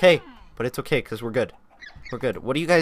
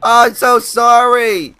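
loud, higher, panicked sorry; loud panicked apology; 7 semitones higher, 9 dB louder than usual. Real, not acted. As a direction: blurt the apology in real alarm. Pushed wrong button, hurt dinosaur.

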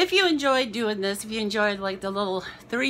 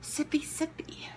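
whispered softly to herself; a soft breathy whisper while sipping a drink; whispered aside; 3 semitones higher, 11 dB quieter than usual. Real, not acted. Winded woman sipping water after dancing, whispering to herself.

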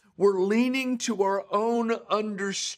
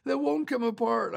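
high-pitched mock whine of a tearful boy; imitated crying child; 9 semitones higher than usual. Frustrated son crying to his dad over stuck trash cans, imitated high tearful whine. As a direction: voice a frustrated kid wailing, pitched well up. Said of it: "He sits down and he's crying".

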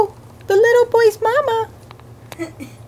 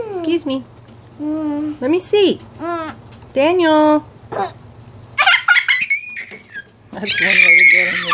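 deep puppet voice; 7 semitones lower than usual. Pretend. Puppet play, deep character.

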